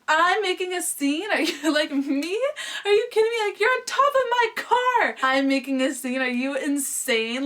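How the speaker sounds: very high, loud outraged disbelief; acted incredulity. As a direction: throw his words back, voice shooting up. Incredulous at boyfriend on her car.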